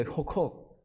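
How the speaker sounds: put-on soft breathy gasps of a calming baby; imitated hiccuping sobs. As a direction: mimic a baby catching its breath. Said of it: "진정하면서 꺽꺽거립니다".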